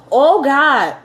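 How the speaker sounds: rising, high-pitched incredulous questions; acted astonishment, pitch building; stunned disbelief. Retold reaction to good test result.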